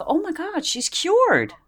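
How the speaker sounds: high, mock-astonished gasp of relief; sarcastic fake wonder, pitched high; mock amazement. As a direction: act dumbstruck at the miracle. Mocking the sudden recovery.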